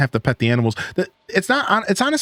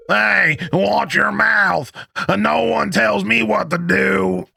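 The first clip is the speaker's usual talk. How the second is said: slow, higher angry shout; angry Pam; 9 semitones higher, much slower than usual. Acted. Pam snaps back at Gus about her tab, angry shout. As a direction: bark it back, defiant and slow. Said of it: "Dude, she's pissed".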